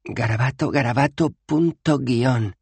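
high, rising list of squiggles; reading symbols. Acted. Bethan reading the runes, rising high. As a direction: spell out each mark.

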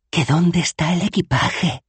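slow, high-pitched urgent hiss; hissed demand. A narrator's reading. Bethan hisses at Dos Flores from behind Rincewind, high and slow. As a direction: hiss the question from behind someone. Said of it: "siseó Bethan".

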